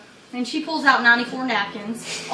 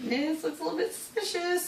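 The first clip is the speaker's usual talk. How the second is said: very soft, slightly raised aside; retold murmur; 4 semitones higher, 12 dB quieter than usual. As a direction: murmur it under your breath. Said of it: "I think I murmured something like".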